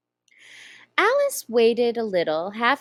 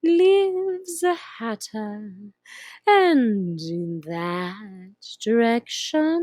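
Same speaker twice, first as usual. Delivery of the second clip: slow, purring Cheshire Cat voice; slow drawling cat; 4 dB louder, slower than usual. Acted. Grinning cat teasing the lost girl, slow and drawn out. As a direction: stretch each word like a lazy cat.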